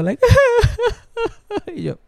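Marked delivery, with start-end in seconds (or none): 0.2-0.9 loud, very high comic wail; imitated crying. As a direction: bawl like grandma, hammed-up. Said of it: "mi abuela llorando". (llanto agudo imitado)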